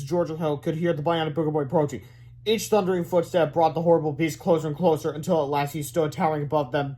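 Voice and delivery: plain reading voice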